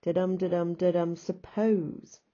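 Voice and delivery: "da-dum" chant slowing into "suppose"; iambic rhythm. Shown as a demonstration. Teacher sketching last rhymed line, iambic rhythm. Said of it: "like this and say".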